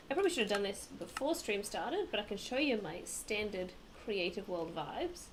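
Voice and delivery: ordinary delivery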